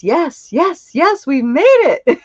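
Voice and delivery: excited cheering. Real, not acted. Host celebrating a viewer's message, excited and laughing. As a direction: cheer like the team just won.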